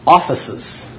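aphesis said as AW-fuh-sus; Greek pronunciation of aphesis. Shown as a demonstration. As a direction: sound out aphesis, stress up front. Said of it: "this is actually is pronounced".

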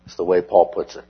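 ordinary talk, nothing marked

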